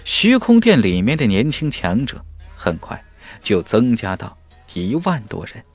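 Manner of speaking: ordinary delivery, nothing marked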